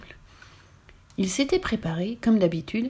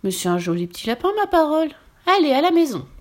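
rising into a high, louder witch cackle-tone; a pleased witch scooping up her catch; lightly voiced witch, bright and delighted; 7 semitones higher, 4 dB louder than usual. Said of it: "ajouta-t-elle".

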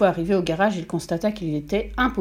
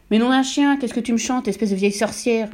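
éclate fort et aigu, puis retombe; cri furieux; 4 semitones higher, 4 dB louder than usual. A narrator's reading. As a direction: hurle ta colère à ta femme. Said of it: "hurla monsieur Verdebois". (le père hurle contre sa femme, furieux)

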